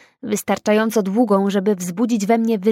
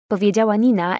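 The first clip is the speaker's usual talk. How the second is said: usual speaking voice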